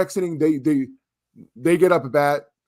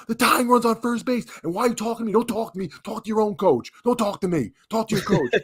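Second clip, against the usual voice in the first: heated retort; 9 semitones higher than usual. Retold or imitated. Retold argument with opposing dad.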